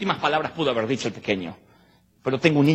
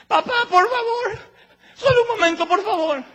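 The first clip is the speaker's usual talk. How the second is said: crying plea. Acted. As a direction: beg through tears for one moment. Isaac begs his father.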